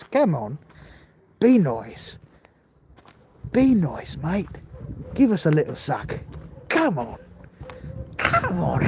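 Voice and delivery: put-on pleading little mosquito with an Australian accent. Mosquito begging for a bite in a joke, mock Australian accent. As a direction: coax them in a hammed-up Australian accent. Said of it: "the Australian s-, mosquito looks at you and he says".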